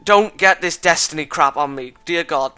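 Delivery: ordinary delivery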